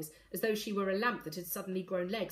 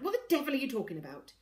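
quick, higher, irritated question; snappish; 6 semitones higher, faster than usual. A narrator's reading. Father rebuffs daughter's question, snappish. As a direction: snap it out in a rush. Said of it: "Corvus snapped".

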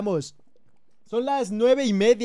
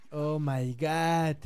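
low, soft, very slow oh my god; awed oh my god; 8 semitones lower, 6 dB quieter, much slower than usual. Real, not acted. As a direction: breathe it out in awe. Awed at volcano. (asombro lento y bajo)

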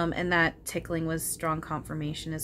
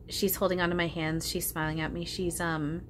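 usual speaking voice

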